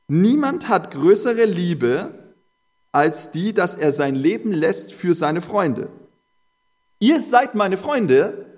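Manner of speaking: slow, weighty Bible reading; preacher lifting the verse to the congregation; solemn scripture reading. Acted. Preacher reading Jesus's words to the congregation, slow and solemn.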